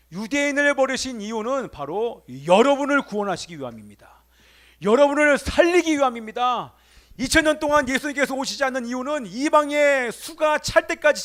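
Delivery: loud, emphatic sermon delivery; full-force preaching. Real, not acted. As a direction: drive each phrase home to the congregation.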